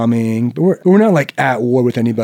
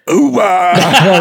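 laughing mock oorah, higher and louder; 1 mock Marine yell; 7 semitones higher, 4 dB louder than usual. Recruiter hollers his battle cry, half laughing. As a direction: bark it like a hyped recruiter. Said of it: "He's like".

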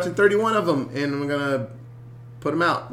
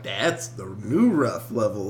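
low, slow jaded voice; 8 semitones lower than usual. Retold or imitated. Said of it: "I'm like".

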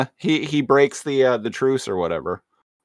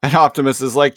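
normal talking voice

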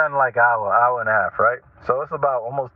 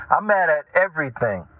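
usual speaking voice

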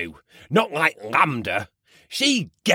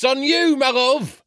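delighted; 12 semitones higher, 3 dB louder than usual. Acted. Delighted at voice switch.

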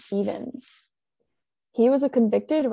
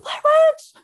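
high, loud spooky reveal; dramatic shout; 10 dB louder than usual. Acted. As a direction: shout it like a horror trailer.

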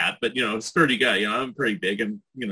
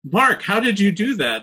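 higher-pitched, impressed disbelief, retold; amazed question; 7 semitones higher, 4 dB louder than usual. Director to the extra after the take, amazed. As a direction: imitate an astonished director asking an extra.

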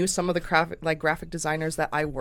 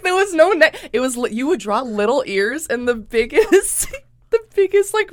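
laughing; 8 semitones higher, 6 dB louder than usual. Real, not acted. Laughing over doodle memory.

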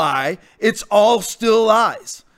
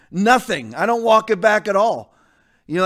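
usual speaking voice

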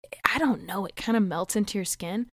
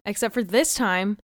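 ordinary delivery

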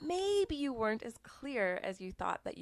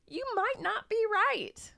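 high, slow; 13 semitones higher, 4 dB louder, slower than usual. Shown as a demonstration. Teasing contrast, then whispers.

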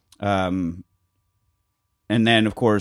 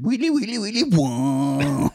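high repeated lick into a long wail; air guitar noises; 7 semitones higher, slower than usual. Retold or imitated. Quoting the funniest Aqua Teen bit, imitated guitar noises. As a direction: mimic a guitar solo by mouth. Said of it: "like, air guitaring".

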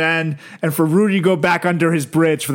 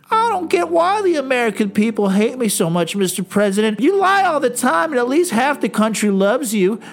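higher complaining whine to the president; whiny senator; 6 semitones higher than usual. Retold or imitated. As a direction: plead with mock self-pity. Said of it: "It's like".

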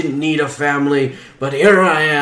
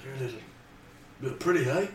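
much softer, lower leering voice; lightly voiced creep; 6 semitones lower, 19 dB quieter, much faster than usual. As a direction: leer at the lady.

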